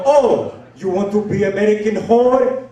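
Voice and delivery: put-on loud, high outrage in a foreign accent; Abdul. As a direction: raise your voice, scandalized, with a thick foreign accent. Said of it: "his name is Abdul".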